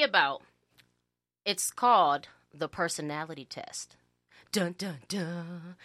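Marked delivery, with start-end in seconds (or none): 4.5-5.8 sung dun dun dun, fading; mock suspense music with the voice; dramatic reveal sting. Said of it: "I always wanted to do that".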